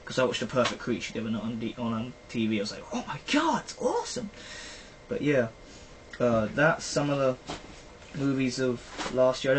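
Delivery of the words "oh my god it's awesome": high-pitched squeal of delight; hammed-up fanboy squeal; gushing excitement. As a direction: re-enact being thrilled, voice shooting up. Retells own excited reaction to film.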